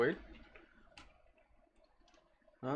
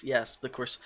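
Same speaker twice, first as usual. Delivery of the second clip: usual speaking voice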